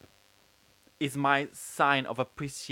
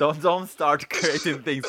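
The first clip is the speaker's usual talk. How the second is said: laughing; 4 semitones higher, 6 dB louder than usual. Real, not acted. Laughing plea to co-host.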